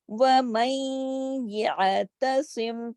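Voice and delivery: يَعْتَصِمْ slowly, عین held silent; slow Qur'anic recitation. Shown as a demonstration. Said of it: "جزم کے ساتھ ہے".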